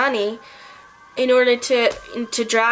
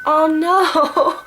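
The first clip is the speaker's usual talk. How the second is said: high, hammed-up tearful wail; imitating a crying heroine; mock crying; 5 semitones higher, 4 dB louder than usual. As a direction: wail it in pretend sobs. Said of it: "like, crying".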